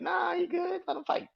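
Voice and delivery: high mock shout telling Jimmy to let him fight; mock dismissive shout. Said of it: "He's just grabbing Jimmy like".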